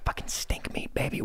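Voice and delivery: soft husky whisper. Pretend. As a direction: whisper it lovingly, like a treat. Echoes stink meat joke.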